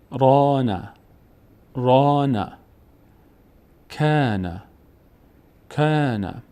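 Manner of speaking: each word like تاب or کان with the first vowel held long; slow drill, stretch the alef in تاب, بات, زاد; long alef sound. Shown as a demonstration. Teacher models practice words for learners, long drawn-out alef.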